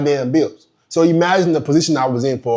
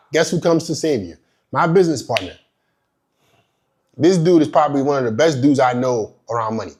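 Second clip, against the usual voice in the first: usual speaking voice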